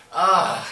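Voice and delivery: loud, high shuddering "ugh"; real groan of the creeps; creeped-out groan. Real, not acted. Telling viewers her ghost story, creeped-out groan. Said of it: "giving me heebie-jeebies".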